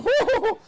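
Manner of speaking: loud, very high imitated hoots; whooping it up like happy Henry. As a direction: let out gleeful falsetto hoos. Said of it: "Henry started to get happy".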